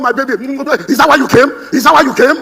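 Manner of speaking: wife accusing her husband on arrival, loud and high; indignant wife. Acted. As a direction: throw it at him like an offended wife.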